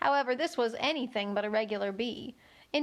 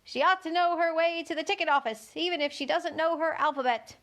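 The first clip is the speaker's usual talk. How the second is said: louder, higher goat voice; 6 semitones higher, 3 dB louder than usual. A narrator's reading. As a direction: bleat it out boldly. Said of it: "in a loud voice".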